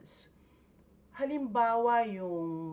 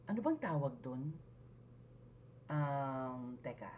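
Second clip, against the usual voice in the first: hesitant, searching for the word, lower and softer; demonstrating fillers; 6 semitones lower, 7 dB quieter than usual. Shown as a demonstration. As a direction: stall quietly with filler words. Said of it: "yung parang fillers". (nag-aalangan, naghahanap ng salita)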